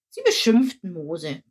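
ordinary delivery

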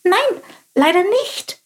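high, curious little bird voice; little sparrow voice. Acted. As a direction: chirp it like a small inquisitive sparrow. Chilp answers. (hohe, neugierige Spatzenstimme)

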